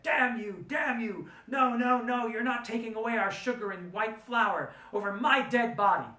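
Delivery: high, loud furious outburst on the edge of tears; angry tears. Retold or imitated. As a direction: scream it at him, choking with rage. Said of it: "burst into angry tears and said".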